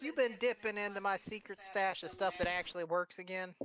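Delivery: put-on high, knowing old lady; teasing granny voice. Philia teasing Buster about her stash, high granny voice. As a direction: tease him like a wise grandma. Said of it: "She's like".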